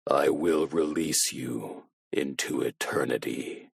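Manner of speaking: cold voice-changer promise; low robotic monotone. A narrator's reading. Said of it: "robotic, monotone voice".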